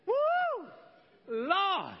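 high, loud hooting whoop; excited whoop. Real, not acted. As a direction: let out a joyful holler. Preacher's reaction shout.

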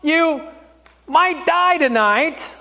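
high, mock-kindly talk to a small child; mock-reassuring grown-up; adult telling a kid. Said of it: "like you're telling the kid".

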